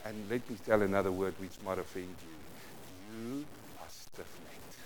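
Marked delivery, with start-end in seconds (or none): 3.2-4.8 softer, slow and calm, almost kind; gentle rebuke. Shown as a demonstration. Preacher rebuking the congregation gently, soft and slow. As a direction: let the hard word land softly. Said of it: "I can say it with my angel face".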